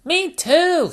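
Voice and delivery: put-on very high little piglet boy; George's voice. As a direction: chirp it up high like a toddler. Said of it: "said George".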